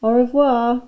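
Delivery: deliberately English-sounding French; un-French accent. A narrator's reading. Dennis calls back to his teacher, in un-French accent. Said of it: "in the most un-French accent he could manage".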